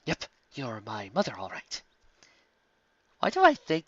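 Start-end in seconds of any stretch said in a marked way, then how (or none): 0.0-1.8 dry resigned mutter. A narrator's reading.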